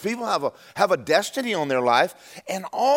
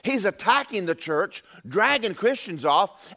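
normal talking voice

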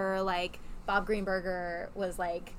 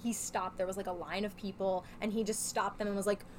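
ordinary talk, nothing marked

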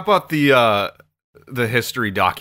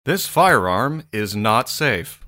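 very slow, deliberate safety warning; gun safety voice; 3 dB louder, much slower than usual. Shown as a demonstration. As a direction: spell out each word like a training video. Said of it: "that's more like the gun safety voice".

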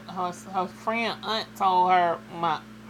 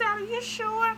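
much higher, doubtful mother; mimicking mom; slower than usual. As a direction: play the unsure mom.

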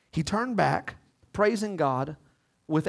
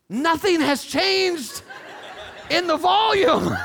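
laughing retort; 11 semitones higher, 5 dB louder, slower than usual. Real, not acted.

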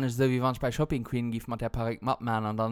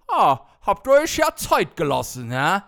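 mock waitress; 4 semitones higher, 8 dB louder than usual. Quoting the waitress.

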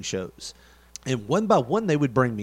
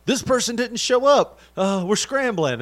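mock frantic promoter; 9 semitones higher, 5 dB louder than usual.

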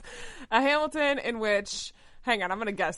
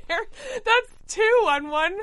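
incredulous; 12 semitones higher, 5 dB louder, much slower than usual. Real, not acted. Disbelief at repeat trope.

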